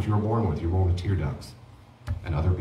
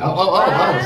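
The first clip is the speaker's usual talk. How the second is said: excited; 10 semitones higher, 8 dB louder than usual. Real, not acted. Excited reaction to laughter.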